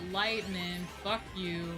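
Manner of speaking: normal talking voice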